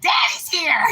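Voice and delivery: high excited dog voice; voicing the dogs. Owner voicing her dogs as the truck arrives, high excited. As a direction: squeak it like an eager pup. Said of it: "they're like".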